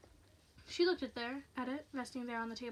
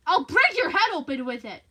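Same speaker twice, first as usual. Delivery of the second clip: loud, higher, furious yell; shouted threat; 5 semitones higher, 16 dB louder than usual. Acted. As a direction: threaten the intruder at full voice. Frightened woman threatens intruder.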